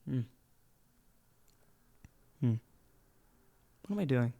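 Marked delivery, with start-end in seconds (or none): 3.9-4.3 very soft, almost breathless question; hushed morning question to yourself; whispered self-question. Shown as a demonstration. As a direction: mutter it to yourself, barely voiced. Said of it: "Go ahead, this is you".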